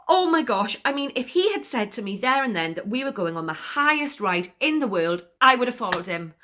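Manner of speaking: high, loud gush after a kiss; swooning excitement. Acted.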